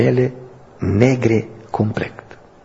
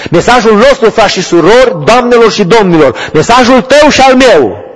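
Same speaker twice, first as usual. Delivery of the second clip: much higher and louder, building to the point; climactic preaching shout; 11 semitones higher, 8 dB louder than usual. Real, not acted. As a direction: hammer home the message. Preaching at full force. (predică la apogeu, strigată)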